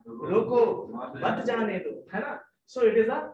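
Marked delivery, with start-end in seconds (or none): 0.1-1.9 drawn-out "रोको", break, then "मत जाने दो" together; pause after रोको. Shown as a demonstration. Teacher repeats the misreading for students, pausing after रोको. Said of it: "उसने क्या पढ़ा?".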